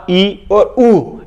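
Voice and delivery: teacher stretching ई and ऊ to show long vowels; drawn-out long vowels. Shown as a demonstration. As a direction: stretch ई and ऊ very slowly. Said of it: "यहाँ उदाहरण तो लिखे ही नहीं".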